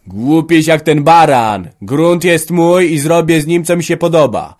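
loud, high, drawn-out shouting; furious quarrel. Acted. Father and son fight over the land, shouting furiously. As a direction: explode at each other, punching every retort. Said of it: "krzyknął, bo już nie mógł ścierpieć".

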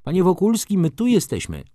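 high-pitched call to a companion; Felicja calling out. A narrator's reading. Felicja calls to Wokulski from nearby, high calling voice. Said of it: "odezwał się głos panny Felicji".

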